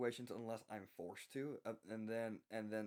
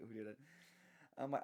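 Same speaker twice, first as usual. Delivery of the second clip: ordinary delivery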